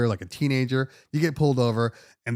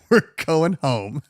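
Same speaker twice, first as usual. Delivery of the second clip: laughing, slower; 2 semitones higher, 4 dB louder, much slower than usual. Retold or imitated. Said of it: "and I said".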